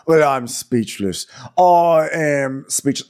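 slow, stretched-out disbelief; hammed-up stunned reaction; mock speechless. Acted stunned reaction, drawn out.

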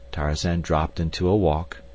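ordinary delivery

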